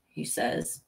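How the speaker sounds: usual speaking voice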